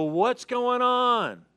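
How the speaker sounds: whining. Retold or imitated. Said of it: "you turn and go".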